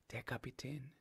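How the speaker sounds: name him timidly, almost under your breath; small, reluctant admission; ashamed, hushed. A narrator's reading. Ashamed, reluctant answer after breathing yes.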